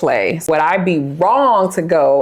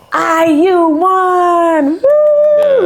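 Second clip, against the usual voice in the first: excited cheer; 11 semitones higher, 3 dB louder, much slower than usual. Real, not acted. Cheering the team's win.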